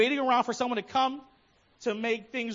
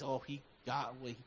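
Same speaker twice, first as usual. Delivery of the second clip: secret gloating; 9 semitones lower, 7 dB quieter than usual. Retold or imitated. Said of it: "secretly celebrated someone's failure".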